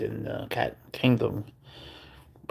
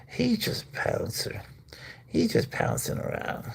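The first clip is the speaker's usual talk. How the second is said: much higher, slow whispered coo; 12 semitones higher, 4 dB quieter than usual. Real, not acted. Owner coos the cat's name slowly. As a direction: stretch it out cooing.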